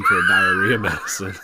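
telling the joke, cracking up at the end; laughing through the joke. Real, not acted. Reading a dad joke, cracking up at the end. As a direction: break into laughter on the punchline. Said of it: "You gotta say the joke".

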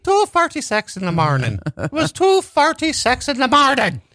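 high, hammed-up mangled Irish; absurdly botched Irish accent; garbled Irish accent. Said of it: "someone from Ireland having a stroke".

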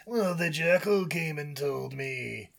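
slow, raspy snake voice; drawn-out creature reply; Kaa. Acted. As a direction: drag the answer out like a lazy serpent. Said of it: "a deep, raspy voice".

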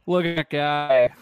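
slow playful jab at his friend; teasing call-out. Real, not acted. Ribbing his friend over the suit photo, loud slow tease.

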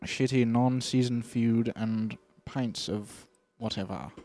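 slow mock British accent. King advertising the new accent, slow British accent. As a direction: list it slowly in put-on British. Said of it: "The new British accent".

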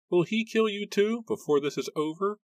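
put-on high, anguished old man's plea; old man's fearful cry; frightened old man. A narrator's reading. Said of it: "he muttered to himself".

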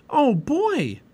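high, loud burst of glee; delighted. A narrator's reading. Girl invited to feed the dogs, delighted.

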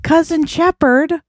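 surprised question. Acted. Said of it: "and you say".

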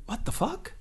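a hushed, high what-the-fuck at the red card; stunned disbelief. Retold or imitated. Player shown a red card, high hushed disbelief. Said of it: "I'm thinking".

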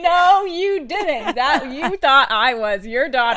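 slow, high laughing protest; drawn out through giggles. Real, not acted. Sister laughing at being taken for the daughter.

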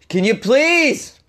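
very high, drawn-out slow exasperation; exasperated plea. Retold or imitated. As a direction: beg them to stop, at the end of your rope. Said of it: "I just kinda lost my shit and was like".

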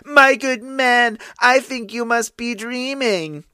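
barely suppressed laugh. A narrator's reading. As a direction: tease him, holding back a laugh. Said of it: "could hardly keep a straight face".

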